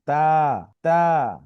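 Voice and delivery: তা with a short, moderate hold on the vowel; slight stretch. Shown as a demonstration. Teacher models the right length for pupils, slightly lengthened তা. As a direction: draw out তা just a little. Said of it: "অল্প টান".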